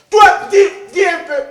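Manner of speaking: loud, high command; sharp shouted demand; bossy shout. Acted. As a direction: bark the order at him. Bossy host ordering guest.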